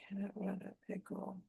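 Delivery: whispering the line to herself; barely voiced, trying out the rhyme; whispered draft. Real, not acted. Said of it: "whispering draft lines to herself".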